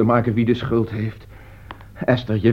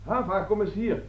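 high, distant-sounding call; soft-level, raised-pitch summons; 7 semitones higher, 13 dB quieter than usual. Acted. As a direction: call someone over from afar. Calls Hava to join them.

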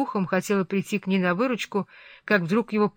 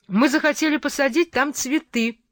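high eager exclamation; cry out; 7 semitones higher, 3 dB louder than usual. A narrator's reading. Catherine defiantly crying out to her father-in-law. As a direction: call out the wish with spirit. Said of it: "крикнула она".

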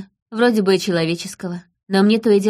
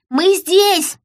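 very high, raised yell; child hollering; 11 semitones higher, 4 dB louder than usual. A narrator's reading. Daughter hollering to her father through snow, high yell. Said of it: "заголосила она".

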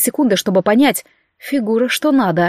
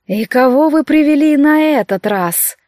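higher, slow, silky female voice; melodic insinuating woman's voice; 4 semitones higher, slower than usual. A narrator's reading. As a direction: coax them in, melodic and sly. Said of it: "мелодичный вкрадчивый женский голос".

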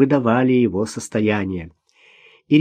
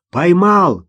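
raised, high answering call; shout; 5 semitones higher, 5 dB louder than usual. A narrator's reading. Man in the water confirms the catch, shouting. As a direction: call it back loudly. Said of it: "крикнул он".

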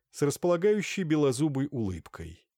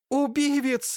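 very high, loud wail; yelling accusation; 5 dB louder, slower than usual. A narrator's reading. Marfusha accuses the doctor of murder, high-pitched yell. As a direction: scream it out, drawing on a high note. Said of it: "заорала Марфуша".

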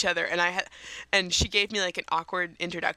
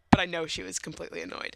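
ordinary talk

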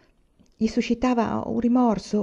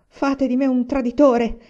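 raised, higher voice of outrage; protesting shout; 5 semitones higher, 6 dB louder than usual. A narrator's reading. Son accuses his father, shouting in protest. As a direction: cry out in protest. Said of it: "gridò".

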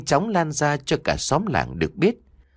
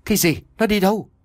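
alarmed outcry; 8 semitones higher, faster than usual. A narrator's reading. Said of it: "hốt hoảng nói lớn lên".

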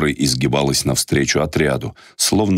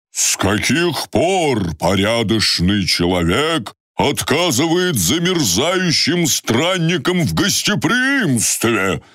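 indignant; 10 semitones higher, slower than usual. Acted. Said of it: "возмущённо поинтересовался старик".

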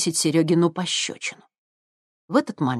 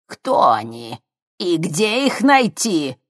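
sinister crone; 3 semitones higher, 4 dB louder, slower than usual. Acted. Sinister old woman questions.

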